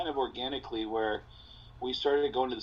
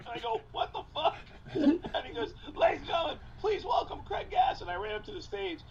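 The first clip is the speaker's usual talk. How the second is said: much higher, laughing mock announcement; faster than usual. Comic retelling his intro, laughing and much higher. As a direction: call it out while cracking up.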